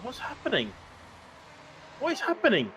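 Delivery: high, baffled question; bewildered. Real, not acted. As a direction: sound truly puzzled. Bewildered commentator.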